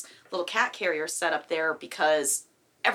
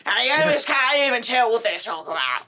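loud, high Cockney British accent; 5 semitones higher, 5 dB louder than usual. Shown as a demonstration. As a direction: belt it out in broad Cockney. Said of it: "Cogni was sort of looked upon as, like, the lower type of British accent".

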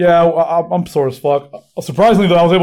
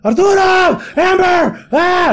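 mom shouting; much slower than usual. Retold or imitated. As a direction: yell names like a frantic mom. Mom voiced yelling in story.

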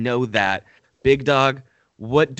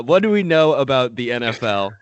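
usual speaking voice

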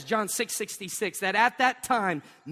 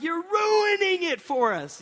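mock complaint; 9 semitones higher, slower than usual. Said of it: "We're like".

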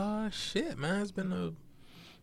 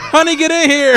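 much higher, loud, laughing; panicked high call; 14 dB louder than usual. Pretend. Acting panicked call to wife.

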